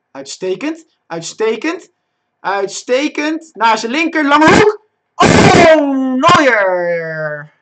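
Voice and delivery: building from eager to a high shout; excited goal-chance commentary. Real, not acted. Player willing his shot in, rising to an excited shout. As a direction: stretch and raise each word until you are yelling.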